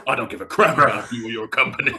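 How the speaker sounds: gruff mock dismissal; lightly voiced angry Snake; quoting Snake. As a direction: snarl it at the company man. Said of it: "he goes".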